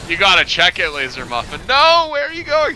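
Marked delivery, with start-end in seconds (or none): 1.7-2.7 sudden high cry of disbelief; surprised. Real, not acted. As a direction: yelp at the bad play. Caster reacts to play.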